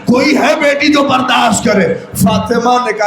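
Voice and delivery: usual speaking voice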